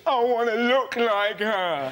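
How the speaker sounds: slow, drawn-out cartoon bear voice; Baloo-like voice. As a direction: stretch it out slowly like Baloo. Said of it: "you sounded like Baloo".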